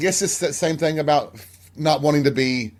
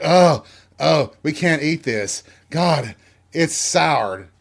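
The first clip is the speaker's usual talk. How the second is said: loud mock groans of disgust, pitched up; imitating dad recoiling from a bad bite; 3 semitones higher, 4 dB louder, slower than usual. Dad tasting spoiled watermelon before the kids, disgusted.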